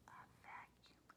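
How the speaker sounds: hushed, voiceless whisper; whispered aside. Real, not acted. Whispered aside naming what appears.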